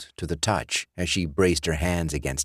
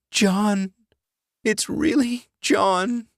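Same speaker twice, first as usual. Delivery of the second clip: much higher, shaken woman's voice; frightened Alice; slower than usual. Acted. Alice seeing her dead ex at the door, high frightened cry. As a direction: cry out in disbelief and fear.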